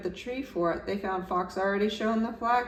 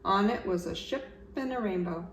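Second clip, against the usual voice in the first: ordinary delivery, nothing marked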